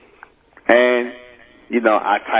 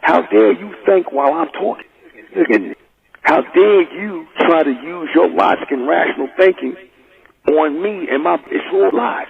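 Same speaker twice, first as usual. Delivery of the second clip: raised, hammed-up voice of an offended bigot; 7 semitones higher than usual. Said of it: "that's really what he was pissed off about".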